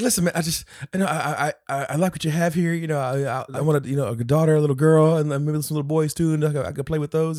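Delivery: high, stammering mock appeasement; nervous babbling. Man placating the girls' dad, mock nervous rambling. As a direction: babble excuses in an imitated panic.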